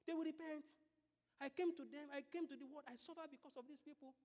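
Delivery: hushed, acted intercession; pleading advocate. Jesus as lawyer pleading with the Father, softly pleading. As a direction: plead softly, as if praying for others. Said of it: "that will be pleading every time".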